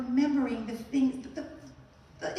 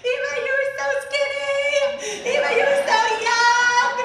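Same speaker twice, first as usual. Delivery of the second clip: high, loud laughing squeal of friends teasing; 11 semitones higher, 8 dB louder than usual. Retold or imitated. Friends teasing Eva over old clips, high laughing squeal.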